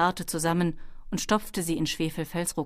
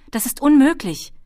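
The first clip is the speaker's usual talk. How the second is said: high, loud objection; calling out; 8 semitones higher, 10 dB louder than usual. A narrator's reading. Ben disputes the kobold's map reading, calling out loudly. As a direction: cry out in disbelief. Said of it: "rief Ben".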